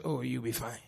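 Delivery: calm low you'll be fine; calm reassurance. Shown as a demonstration. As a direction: say it gently, no fuss. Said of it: "I say".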